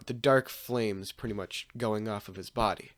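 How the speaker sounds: plain reading voice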